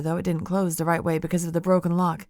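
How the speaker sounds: plain reading voice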